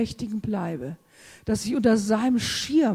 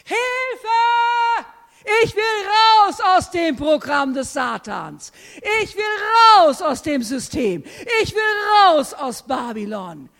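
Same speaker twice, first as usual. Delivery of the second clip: loud, high desperate shout; full-force preacher's cry; screamed cry for help; 8 semitones higher, 5 dB louder than usual. Shown as a demonstration. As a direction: scream to get out. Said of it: "Deshalb schrei ich".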